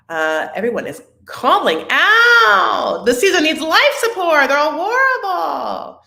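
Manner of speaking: hammed-up high shout for help; theatrical mock alarm call. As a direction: cry out like calling for rescue. Mock emergency call for season.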